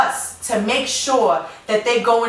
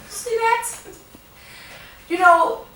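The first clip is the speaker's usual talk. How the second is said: emotional; 10 semitones higher than usual. Real, not acted. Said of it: "I just get really emotional".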